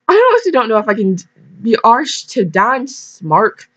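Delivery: hammed-up Irish accent; amateur Irish brogue, then slowing; attempted Irish accent. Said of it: "I'm trying to go for Irish".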